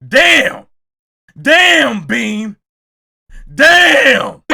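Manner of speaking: high, drawn-out shouted damn; big loud reaction; loud exclamation. Real, not acted. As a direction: holler it out, stretching the vowel. Mock-shocked calling out of Bean.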